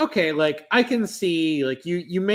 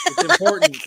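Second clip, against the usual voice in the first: mock serious; 13 semitones higher, 6 dB louder, faster than usual. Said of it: "mock solemn agreement".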